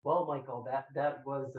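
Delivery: ordinary talk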